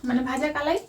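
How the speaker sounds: hushed voice. Real, not acted. Secret birthday prep, hushed so sister can't hear. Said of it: "আস্তে আস্তে কথা বলতে হবে".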